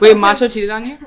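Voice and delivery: surprised laugh at the news; shocked disbelief. Real, not acted. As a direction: gasp it out through a laugh. Shocked question to friend.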